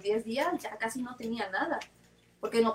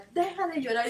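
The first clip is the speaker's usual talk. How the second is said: higher-pitched mock scolding; scolding mother; 5 semitones higher than usual. Mother telling her crying daughter to stop, mock scolding.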